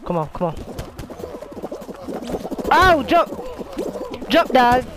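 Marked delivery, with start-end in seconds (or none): 2.7-4.9 high, panicky game yelling; urgent yell. Real, not acted. Player urging his character to jump, urgent yell. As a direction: cry out commands at a jumping character.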